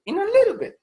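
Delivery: "little" squeaked very high and loud, then falling; exaggerated high "In a little bit"; very high pitch. Shown as a demonstration. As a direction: jump way up on "little". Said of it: "uno sube la frecuencia fundamental".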